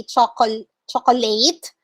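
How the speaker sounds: chocolate said as "cho-ko-late"; chocolate mispronounced, ending like late; wrong pronunciation demo. Shown as a demonstration. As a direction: say chocolate in three full syllables. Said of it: "you don't say".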